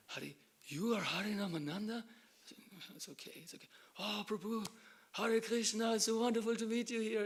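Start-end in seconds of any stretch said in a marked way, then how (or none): 0.1-2.0 surprised. Retold or imitated. Recognising a famous devotee. 3.0-3.7 whispered, brushing off the fuss; modest whisper. Retold or imitated. 4.0-7.3 louder, higher, beaming welcome; overjoyed greeting. Retold or imitated. Joyful greeting retold.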